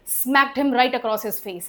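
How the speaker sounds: slow, with the stress on him and right; emphatic right. Shown as a demonstration. Teacher shows the emphatic right in a slow example. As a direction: hit right across his face slowly. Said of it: "ये right मतलब emphasis है".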